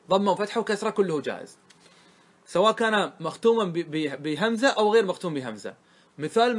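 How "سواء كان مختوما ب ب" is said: usual speaking voice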